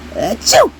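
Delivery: a short sneeze after a hesitant uh. Acted. Lightheaded toy sneezing mid-sentence. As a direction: sneeze it out, feeling woozy. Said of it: "I'm feeling kind of lightheaded".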